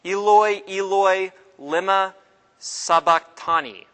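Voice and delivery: a loud anguished call from the cross; loud cry. Retold or imitated. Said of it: "Jesus cried out in a loud voice".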